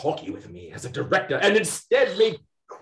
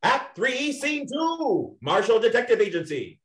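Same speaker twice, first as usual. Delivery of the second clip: announcing the act and scene; a narrator's scene announcement; 5 semitones higher than usual. Acted. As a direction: read it like a stage heading.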